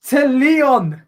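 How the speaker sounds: high, slow, emphatic commentary; excited goal confirmation. Real, not acted. Commentator confirming Lyon have stolen it, excited. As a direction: stretch each phrase in high excitement.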